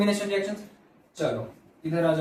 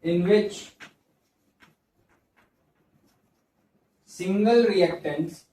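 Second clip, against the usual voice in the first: word-by-word classroom dictation, long gaps; slow dictation; much slower than usual. Real, not acted. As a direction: dictate slowly while students copy it down. Dictating a definition for copying.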